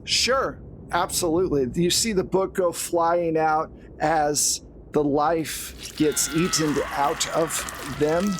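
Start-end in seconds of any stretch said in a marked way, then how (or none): none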